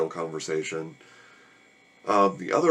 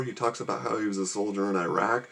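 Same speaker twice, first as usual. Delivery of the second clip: usual speaking voice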